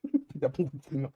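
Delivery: short stifled giggle; snickering at the silly bit; laughing. Real, not acted. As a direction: crack up at your own joke. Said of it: "il faut que je rigole".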